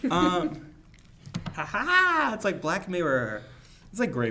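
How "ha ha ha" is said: mock evil laugh.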